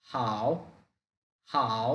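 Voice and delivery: হাও dipping low then rising; হাও in the broken third tone; Mandarin third tone. Shown as a demonstration. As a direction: break হাও with a low dip. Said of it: "ভেঙে বলতে হবে".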